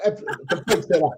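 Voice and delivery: slow words through a real laugh. Real, not acted. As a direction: drag it out while laughing at the prank. Laughing while telling the prank.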